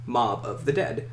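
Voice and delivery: usual speaking voice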